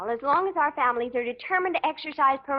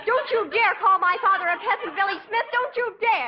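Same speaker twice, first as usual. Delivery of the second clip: angry outburst; 7 semitones higher than usual. Acted. Daughter scolds her fiancé for calling her father a peasant, angry. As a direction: defend father hotly.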